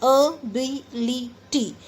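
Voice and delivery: Ability as a-bi-li-ti in separate beats; syllable by syllable. Shown as a demonstration. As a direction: keep a and bi apart in ability. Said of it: "अभी नहीं".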